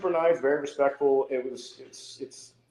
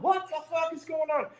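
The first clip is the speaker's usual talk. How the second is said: much higher, softer stunned retelling of his panic; shocked outburst; 6 dB quieter than usual. Retold or imitated. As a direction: blurt it up high, caught off guard. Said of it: "I'm like".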